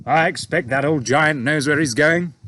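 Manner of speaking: a hint of a breezy young officer; young pilot. A narrator's reading. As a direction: pilot answering his boss, lightly voiced breezy officer. Pilot voiced in read story.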